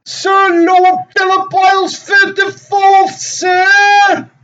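high, slow, loud mock plea; pleading orphan. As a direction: cry it up to a grand lord. Orphan addressing a lord.

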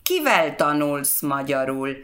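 normal talking voice

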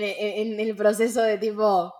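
ordinary talk

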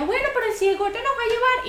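high mock voice of the naive friend; mimicking the friend. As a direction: imitate the gullible friend pleading.

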